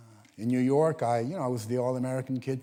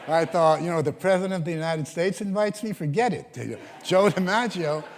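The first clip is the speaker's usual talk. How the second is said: louder, breathless with delight, laughing through it; excited laughing; 5 semitones higher, 6 dB louder than usual. Real, not acted. Telling the audience about DiMaggio, loud and laughing. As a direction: relive the thrill of the invite.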